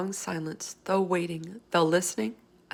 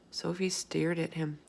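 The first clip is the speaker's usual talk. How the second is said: plain reading voice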